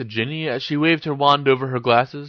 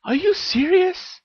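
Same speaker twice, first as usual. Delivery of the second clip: very high, slow disbelieving question; surprised; slower than usual. A narrator's reading. Ginny hears Harry still wants her, surprised and high. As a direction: ask in surprise, pitch soaring.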